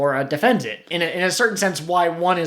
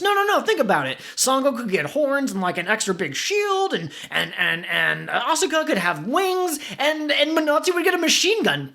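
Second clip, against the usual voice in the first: high, nerdy eager voice; a know-it-all jumping in, higher; Pete character voice; 8 semitones higher than usual. Acted. Pete argues excitedly.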